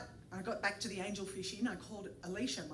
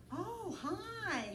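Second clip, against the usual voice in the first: a higher, lightly feminine "Oh, hi"; imitating Pauline on the phone; 11 semitones higher than usual. Narrator voicing Pauline answering the phone in a lightly feminine voice. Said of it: "trying to impersonate a woman".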